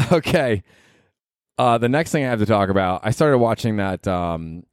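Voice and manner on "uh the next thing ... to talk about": normal talking voice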